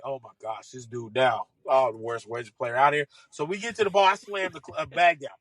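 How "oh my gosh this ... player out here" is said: mock dismay. Mocking the golfer's gripe, retold.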